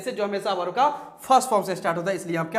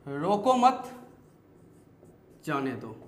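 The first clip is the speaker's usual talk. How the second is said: "रोको मत" held together, pause, then lower "जाने दो"; pause after मत; 6 semitones lower, 4 dB quieter, slower than usual. Shown as a demonstration. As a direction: break after मत, let जाने दो drop. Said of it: "रोको मत" के बाद कॉमा". (मत के बाद ठहराव)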